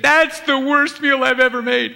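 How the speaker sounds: high, loud, wailing self-pity; wife wailing about her dinner, high and loud; wife's lament. Retold or imitated. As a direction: wail it like the wife. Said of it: "when she said".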